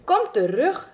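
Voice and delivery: high, loud call. Acted. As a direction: call someone back from a distance. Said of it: "riep Langaneki".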